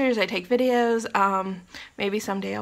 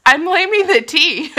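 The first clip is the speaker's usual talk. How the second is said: silly; 6 semitones higher, 7 dB louder than usual. Real, not acted. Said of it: "I'm in a silly mood".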